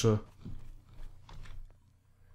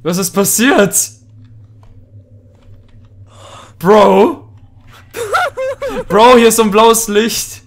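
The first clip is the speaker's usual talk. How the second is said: calling out; 5 semitones higher, 7 dB louder than usual. Real, not acted. Calling partner who vanished.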